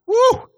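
high exultant woo; joyful whoop. Real, not acted. Preacher celebrating joy with the church, high joyful whoop. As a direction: let out a happy whoop.

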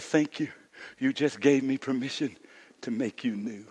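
hushed confession. Acted. Pastor quietly confesses his teenage past to the church. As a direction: bring it down to a near murmur.

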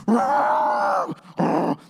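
loud, rasping imitation of a charging elephant; elephant roar. Bull elephant charges walking group, imitated roar. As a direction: bellow out two big animal roars. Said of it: "and he's like".

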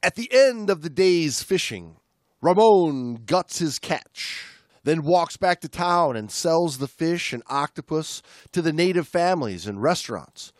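steady read-aloud narration; book reading. Acted. Podcast host reading a diving memoir aloud, narrating. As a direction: read the passage to the listener.

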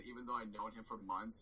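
ordinary talk, nothing marked

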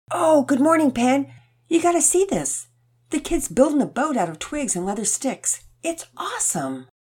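put-on high, eager boy; an excited kid at the TV; young boy voice.

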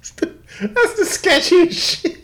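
high, slow, squeaky laughing line. Real, not acted. Laughing with his friend over the photo, high and slow. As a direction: squeeze it out between laughs.

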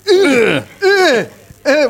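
high, loud imitated effort grunts; loud workout grunts. Imitating a guy hitting a punching bag, loud grunts. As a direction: grunt with each punch, exaggerated.

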